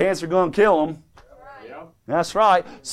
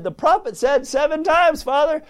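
urging onlooker; 9 semitones higher than usual. Acted. Acts good man urging from bank.

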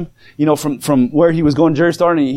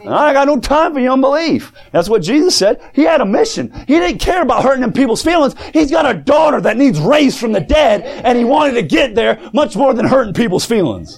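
full-force preaching; 7 semitones higher than usual. Acted. Preacher rallying the church against unbelief, shouting. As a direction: preach it hard to the congregation.

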